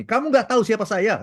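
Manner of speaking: mock furious rebuke; retold angry outburst. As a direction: play the offended director scolding the waiter. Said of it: "dia marah sekali dan dia memaki".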